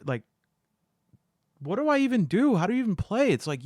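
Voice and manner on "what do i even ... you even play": high, flustered wail of confusion; imitating a lost new player, pitched way up; panicked beginner. Past self at the table, baffled by solo play: high flustered panic. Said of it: "like, or as if they're".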